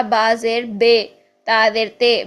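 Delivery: با زیر بے and تا زیر تے with a long e sound; wrong e vowel demo; wrong majhool vowel. Shown as a demonstration. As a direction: drag the kasra into an e sound. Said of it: "مجہول پڑھتے ہیں تو وہ بن جائے گا".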